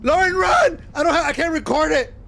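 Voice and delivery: loud frightened yell; real panic, yelling; panicked shout. Real, not acted. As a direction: scream at her to run. Fleeing a monster in game.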